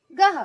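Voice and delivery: गह as quick "gaha"; visarga breath. Shown as a demonstration. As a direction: model गह ending on breath. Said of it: "कैसे उच्चारण करेंगे".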